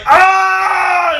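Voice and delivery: high, loud yelp of a wrestler getting cut; mock scream of pain. Wrestler cut by glass, a high loud yelp.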